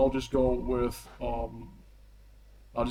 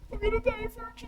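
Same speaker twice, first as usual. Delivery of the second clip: high squeaky whine of doom; panicked squeak; 7 dB quieter, faster than usual. Acted. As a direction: squeal it in mock terror. Squeaky panicked prisoner quip.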